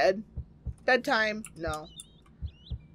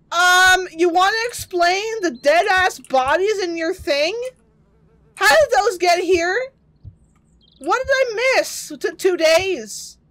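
raised, loud disbelieving questioning; incredulous demand; 6 semitones higher, 5 dB louder than usual. Pretend. Player to game character about the bodies, incredulous demand. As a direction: demand an explanation, pitched up.

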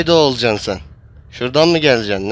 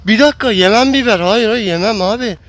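panicked protest; 8 semitones higher than usual. Real, not acted. Caught by the chaser, protesting.

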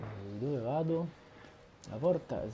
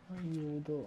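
small, soft frightened plea; scared plea for help; 3 semitones higher, 6 dB quieter than usual. Real, not acted. Player finds the door shut behind him, scared. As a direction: softly squeak out a cry for help.

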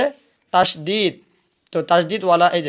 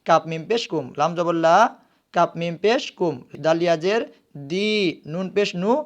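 ordinary delivery

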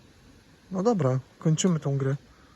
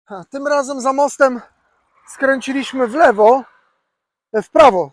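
loud over the wind; 7 semitones higher, 9 dB louder than usual. Real, not acted. Talking while riding a bike.

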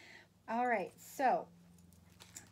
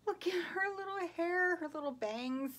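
high-pitched cooing; higher, doting squeal of delight; cooing over a doll; 8 semitones higher than usual. Real, not acted. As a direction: coo over something tiny and cute, voice lifted high.

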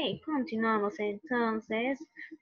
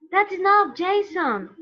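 raised, louder telling-off; acted firm reprimand; 7 semitones higher, 5 dB louder than usual. Drama teacher telling Jason that's enough, firm. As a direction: cut in loudly to stop him.

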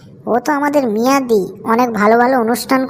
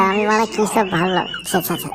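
whispered aside; 6 dB quieter than usual. Acted. Caught out, muttering to himself.

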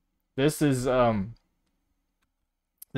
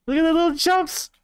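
cooing squeal; 4 dB louder than usual. Real, not acted. Streamer coos at a tiny friend in a high squeal. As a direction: gush over a tiny cute thing.

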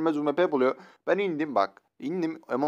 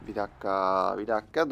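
normal talking voice

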